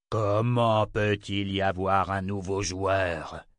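slow, menacing question; drawn-out icy tyrant's menace; chilling voice. A narrator's reading. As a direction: ask it slowly, to freeze their blood. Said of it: "le son de sa voix glaçant les sangs".

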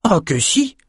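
a high, eager cry; exclaiming. Acted. Young Bannon insisting to drinkers, high eager exclaim. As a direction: blurt it out, keen to correct them. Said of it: "s'écria Bannon".

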